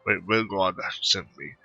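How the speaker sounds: normal talking voice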